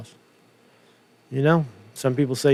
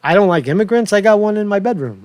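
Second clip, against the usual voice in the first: mock Trump quip; 7 semitones higher, 4 dB louder than usual. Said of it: "It's like".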